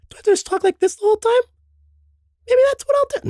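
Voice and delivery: high, loud squeaky falsetto. Shown as a demonstration. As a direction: push the voice up into a strained squeak. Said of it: "Okay, I overdid it".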